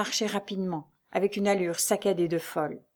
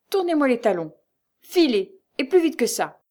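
loud, raised, rough orders; brutal dismissal; 6 semitones higher, 6 dB louder than usual. A narrator's reading. Concierge throwing Lucie out, brutal. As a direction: order the visitor out in separate forceful bursts. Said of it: "Le ton brutal, le langage grossier".

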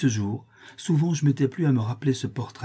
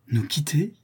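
s'écriant; 5 semitones higher than usual. A narrator's reading. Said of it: "s'écria Clémence".

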